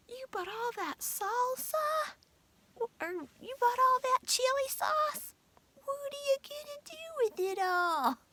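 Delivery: high mock voice of Daryl; mimicking husband. Wife imitating husband Daryl asking about sauce. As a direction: squeak out his nagging questions. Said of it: "Daryl was like".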